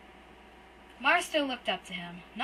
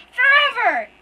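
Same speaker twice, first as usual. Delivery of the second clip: very high, very loud anguished scream; 17 dB louder, slower than usual. Acted. Boy screams at his brother before fleeing, piercing. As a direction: throw the word out in despair.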